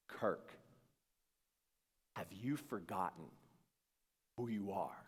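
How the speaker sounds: low, soft, solemn question with long pauses; hushed inner voice. Retold or imitated. New voice in his head questioning Kirk, hushed. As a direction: sink to a near-whisper, phrase by phrase.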